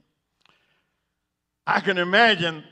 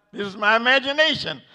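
normal talking voice

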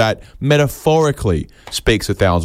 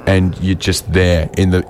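usual speaking voice

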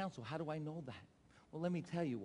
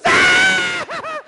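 piercing high, loud mock shriek; wife's scream; 21 dB louder than usual. Husband imitating wife hearing of engagement, excited scream. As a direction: imitate a woman screaming at big news. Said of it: "And my wife goes".

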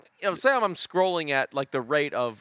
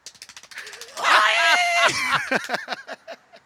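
huge high shrieking sputter of a cartoon jalopy; wild falsetto cartoon engine wail; cartoon car sputter; 4 dB louder than usual. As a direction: scream out a cartoon car breaking down. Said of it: "Hanna-Barbera cartoon of a nineteen twenty car".

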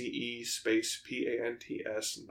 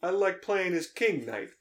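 higher-pitched wiseguy addressing the boss; mock sidekick; 7 semitones higher than usual. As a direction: pitch up like an eager underling. Playing mobster's sidekick addressing Tony.

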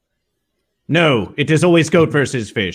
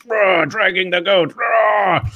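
roaring, shouted dragging noise; mock battle roar; 4 semitones higher, slower than usual. Character acting out dragging the goat, mock roar. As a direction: roar as if hauling a beast away.

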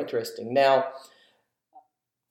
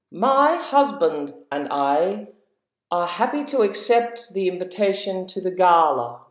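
the Queen; 3 semitones higher than usual. Shown as a demonstration. Host imitating the Queen for kids to guess. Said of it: "the Queen".

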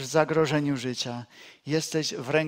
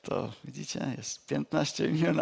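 normal talking voice

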